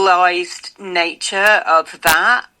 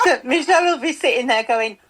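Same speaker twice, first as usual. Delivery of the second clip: ordinary delivery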